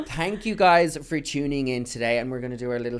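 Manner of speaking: upbeat outro read; podcast sign-off. Acted. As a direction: wrap up the show like hosts.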